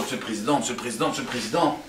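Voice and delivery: low, soft, repeated salutation; deferential greeters. Acted. Passers-by honouring the president, low deferential murmur. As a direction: murmur respectful greetings one after another. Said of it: "tout le monde lui fait du cavote".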